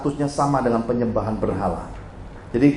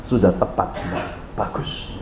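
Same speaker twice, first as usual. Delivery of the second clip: God's quiet voice; 6 semitones lower, 5 dB quieter, slower than usual. Retold or imitated. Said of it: "Tuhan bilang gini".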